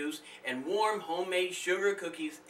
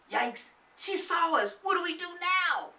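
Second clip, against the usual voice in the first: put-on panicked child voice; 3 dB louder than usual. Child caught spying, panicking.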